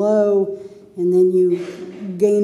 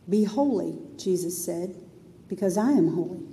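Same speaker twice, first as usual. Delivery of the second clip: hushed, reverent Be holy; softly, Jesus's words; soft quote; 4 semitones lower, 7 dB quieter than usual. Shown as a demonstration. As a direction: pastor quoting Jesus gently. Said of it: "quoting Jesus softly".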